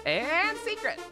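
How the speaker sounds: high, drawn-out announcer flourish; big last-name reveal; hyped announcement. Real, not acted. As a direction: stretch AND up high, then land the name. Announcing gift sub recipients.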